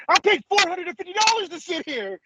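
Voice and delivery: high, indignant mock complaint; outraged guy. Mocking the upset fan to friends, high indignant whine. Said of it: "He's like".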